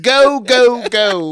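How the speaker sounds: high, loud chanting shout; mock festival-goer's yell. Comic playing dead Tyler at Coachella, hammed-up yell. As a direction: whoop it like a partying ghost. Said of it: "you can still hear him saying".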